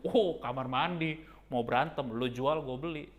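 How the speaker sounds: hammed-up tough-guy challenge; mock fight challenge.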